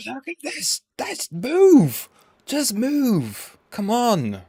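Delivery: very high-pitched frustrated begging; high, desperate exasperated outburst; exasperated pleading. Real, not acted. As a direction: beg it to budge, voice shooting right up. Pleading with the price to move.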